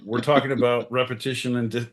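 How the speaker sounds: ordinary talk, nothing marked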